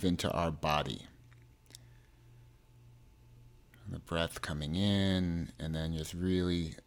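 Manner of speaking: measured meditation delivery, phrases spaced far apart; guided meditation. Acted.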